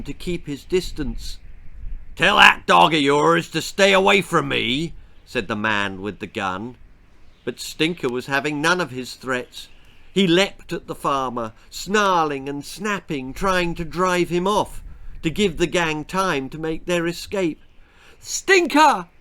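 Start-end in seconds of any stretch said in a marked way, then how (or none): 2.1-4.9 rough farmer voice, louder and threatening; gruff farmer. A narrator's reading. Farmer with gun warns the boy about his dog, gruff threat. 18.2-19.1 high, loud cry of alarm; shout. A narrator's reading. Boy cries out as the farmer aims at his dog, alarmed shout. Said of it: "shouted Col".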